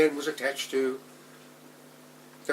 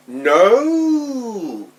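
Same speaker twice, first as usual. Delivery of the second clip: long, high, loud howl; screamed protest; 7 semitones higher, 10 dB louder than usual. A narrator's reading. Child digging in heels on the way to school, long screamed protest. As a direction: scream it out, stretching the vowel.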